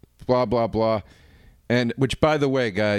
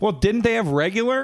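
higher-pitched retold disbelief; exasperated question; 8 semitones higher than usual. Host retelling his question about the juice, exasperated. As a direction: ask it as if baffled, pitched up.

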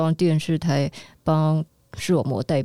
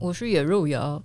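put-on deadpan voice; 2 semitones lower than usual. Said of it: "不要装那个样子啊".

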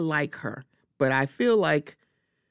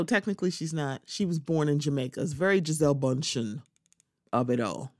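ordinary delivery

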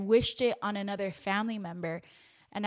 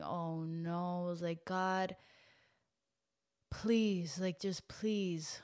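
hushed desperate prayer; 4 semitones lower, 8 dB quieter, much slower than usual. Retold or imitated. Retold prayer on hearing the news.

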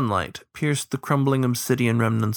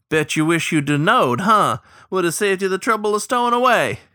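high, loud cackling tease; teasing; 7 semitones higher, 4 dB louder than usual. A narrator's reading. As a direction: needle the stowaway.

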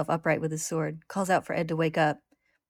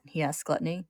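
usual speaking voice

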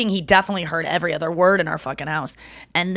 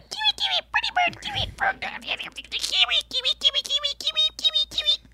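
tiny, very high bird voice; parakeet imitation; 6 dB quieter than usual. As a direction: chirp it like a talking parakeet, softly. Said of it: "that was exactly how he talked".

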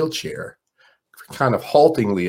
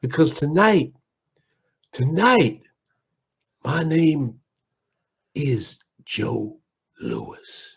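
Ali impression; 5 semitones higher, much slower than usual. Ali's punchline.

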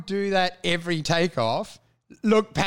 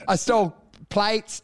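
ordinary talk, nothing marked

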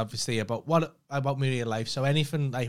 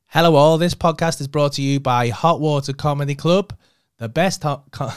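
ad read; 8 dB louder than usual. Shown as a demonstration. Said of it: "I'll play it".

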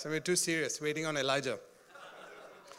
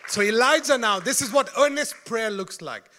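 preaching at full force; 6 dB louder than usual. Real, not acted. Preacher proclaims promise.

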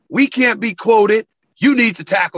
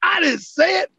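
much higher, shouting no, no, no; panicked protest, pitched way up and shouted; retold frantic denial; 12 semitones higher than usual. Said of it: "And I'm like".

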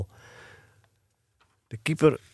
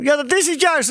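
put-on high, excited boyish exclamation; worked-up boy calling out; crying out; 3 dB louder than usual. A narrator's reading. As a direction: jump in with a high-pitched protest. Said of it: "riep Eddy".

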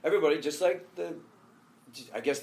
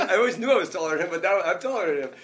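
laughing quote; 6 semitones higher, 8 dB louder, faster than usual. Retold or imitated. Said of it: "He goes".

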